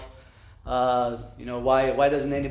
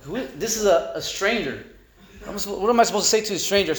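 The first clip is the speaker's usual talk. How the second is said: higher, faster flustered panic; 6 semitones higher, faster than usual. Retold or imitated. Newcomer panics about calling a stranger, in a flustered rush. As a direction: rattle it off in a nervous rush.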